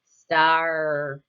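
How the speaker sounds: "Star" stretched out as a model word; emphasised R sound. Shown as a demonstration. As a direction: hold the final R of "Star". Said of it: "Does star have the R sound?".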